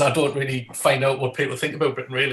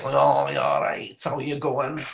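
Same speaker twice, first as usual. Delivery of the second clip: slow, drawled Manchester impression; stretched-out Manchester vowels; Manchester accent; slower than usual. Shown as a demonstration. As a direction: put on a drawling Mancunian greeting. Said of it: "Manchester's like".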